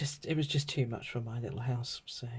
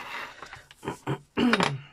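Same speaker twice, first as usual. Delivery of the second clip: clears a croaky throat; loud rough throat clear; 4 semitones higher, 4 dB louder than usual. Real, not acted. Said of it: "Excuse my croaky throat".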